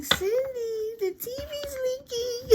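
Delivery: a shrill cartoonish alarm; squeaky panic. Mocking a frightened person who calls Cindy in squeaky panic.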